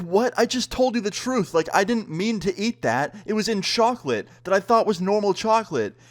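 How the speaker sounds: high-pitched, incredulous self-defence; indignant protest. Retold or imitated. Protesting to a date who accuses him of drug addiction, indignant. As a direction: plead your case in disbelief.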